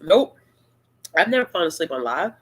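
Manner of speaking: ordinary talk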